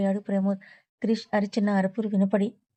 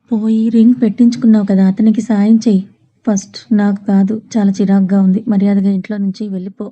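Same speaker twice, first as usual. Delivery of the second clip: louder, furious yelling at her; angry shout; 11 dB louder than usual. Acted. Krish furiously ordering Tripada out of his room, shouting. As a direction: throw her out with your voice.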